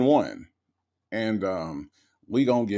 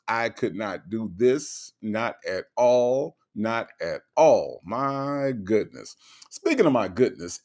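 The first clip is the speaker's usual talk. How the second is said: usual speaking voice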